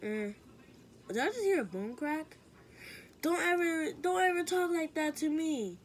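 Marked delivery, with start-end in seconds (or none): 3.2-5.8 angry scolding. Acted. Scolding a friend.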